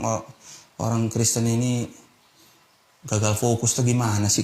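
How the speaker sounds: ordinary talk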